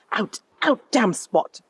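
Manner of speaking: high-pitched stage cry; theatrical Lady Macbeth. Acted. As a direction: declaim it like Shakespeare, pitch jumping up.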